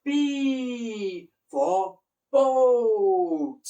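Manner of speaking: high, loud, very drawn-out teaching voice; exaggerated slow phonics demonstration; slow word teaching. Shown as a demonstration. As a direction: stretch each sound out for small children. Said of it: "slowly go into words".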